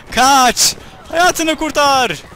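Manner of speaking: hammed-up run-for-your-life scream; mock dramatic shout. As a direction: cry out like a panicked herald. Mock-warning the fleeing enemy.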